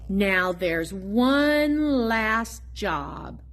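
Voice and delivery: usual speaking voice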